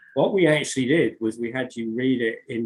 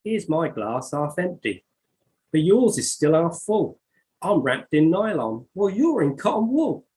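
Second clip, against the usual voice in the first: put-on higher, dramatic poem reading in her own accent; 8 semitones higher, 5 dB louder, slower than usual. Reading her verse back to the guest, higher and dramatic. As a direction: perform the verse with relish, pitch up.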